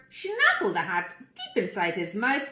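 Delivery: ordinary delivery, nothing marked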